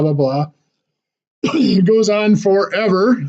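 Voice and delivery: normal talking voice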